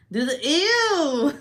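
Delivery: a slow, loud, disgusted ew; loud drawn-out ew. Real, not acted. Grossed out by the thought of a used toy, a loud slow ew. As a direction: stretch the ew out in revulsion.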